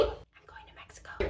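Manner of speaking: playful secret whisper; hushed excited whisper. Pretend.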